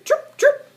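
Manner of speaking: very high, loud chirping; bird chirps. Acted. Friendly marsh birds chirp at the duckling, high chirps. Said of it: "said four friendly little marsh birds".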